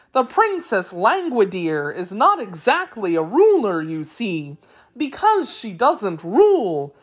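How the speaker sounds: high, singsong creature voice with big swoops; explaining Wheeler. A narrator's reading. Wheeler explaining the royal family, high singsong voice. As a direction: tell it in exaggerated, swooping tones.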